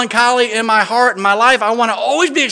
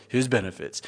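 low, much softer hint of the old raspy preacher; Lester Roloff; 7 semitones lower, 11 dB quieter than usual. Pastor repeats the old preacher's ending, low soft imitation. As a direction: echo his sunken "His benefits". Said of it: "That's how he would say".

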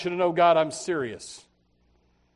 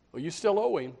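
usual speaking voice

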